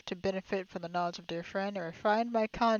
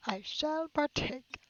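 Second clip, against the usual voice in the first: higher, slow mock-aristocratic drawl; mock posh; 7 semitones higher, much slower than usual. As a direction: ham up his pompous line. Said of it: "Start saying that".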